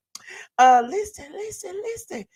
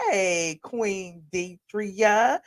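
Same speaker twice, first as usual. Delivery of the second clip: drawn-out greeting; much slower than usual. Real, not acted. Slow greeting to a viewer.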